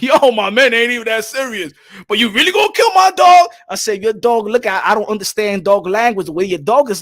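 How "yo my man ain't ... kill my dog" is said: higher, louder shocked pit bull owner; protest, voice climbing to a shout; outraged dog owner. Retold or imitated.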